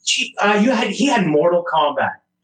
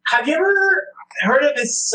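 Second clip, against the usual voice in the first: high-pitched friend impression; Nick; 8 semitones higher than usual. Co-host reading the guest's message aloud, high mimicking voice. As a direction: mimic your buddy, swooping up high. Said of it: "gonna do it in the Nick voice".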